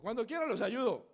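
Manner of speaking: high, loud cheery volunteering; eager offer, imitated. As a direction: pipe up keenly and loudly.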